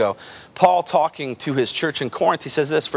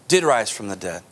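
normal talking voice

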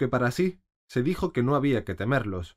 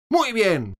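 much higher, loud shout; 11 semitones higher, 7 dB louder than usual. A narrator's reading. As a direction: shout the approval. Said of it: "gritó la reina". (grito de la reina, agudo y fuerte)

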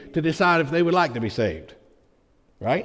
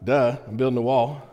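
lower, offhand retold "duh"; mildly sarcastic; 5 semitones lower, 4 dB quieter than usual. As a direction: state the obvious with a light mock shrug in the voice. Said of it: "he wasn't so sarcastic".

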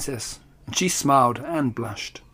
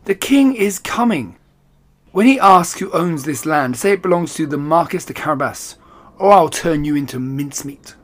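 loud, high threatening call; 3 semitones higher, 4 dB louder than usual. Acted. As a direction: call across the field with a threat. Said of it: "he called to the harvesters".

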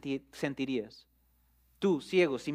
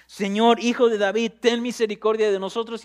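loud pleading shout, pitched up; shouting louder; 4 semitones higher, 5 dB louder than usual. Acted. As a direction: shout it over the crowd telling you to hush. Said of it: "ellos gritaban más aún".

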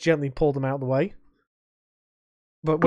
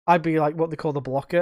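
ordinary delivery

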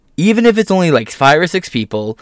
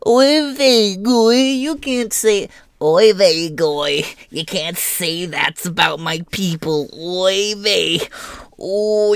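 put-on caricatured Yiddish accent, whiny and high; 7 semitones higher, slower than usual. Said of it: "started saying".

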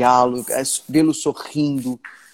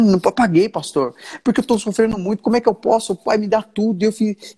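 put-on higher-pitched, pleading retelling of the young man's anguish; a young man torn up with guilt, retold by his pastor, higher and pleading; 7 semitones higher than usual. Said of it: "Ele falou".

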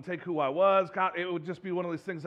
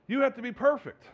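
higher, pushed "You have to be perfect"; raised insistent command; 6 semitones higher than usual. Retold or imitated. Jesus insisting on perfection, higher and pressing. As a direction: lift it up and insist. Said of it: "Jesus comes out and says".

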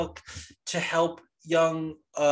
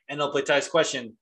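usual speaking voice

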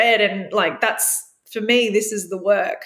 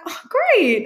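delighted; 11 semitones higher than usual. Retold or imitated. Her delighted reply.

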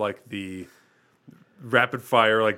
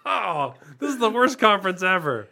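lightly raised customer voice; silly high voice; 4 semitones higher, 4 dB louder than usual. Pretend. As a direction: play a ditzy customer. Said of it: "I don't sound like this".

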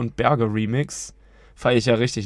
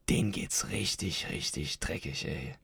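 hushed; 8 semitones lower, 13 dB quieter than usual. Real, not acted. Describing patients' suffering.

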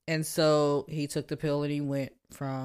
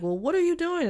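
high, pointed question; exasperated wife; 13 semitones higher than usual. Retold or imitated. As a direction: fire the question up high at him. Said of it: "she's like".

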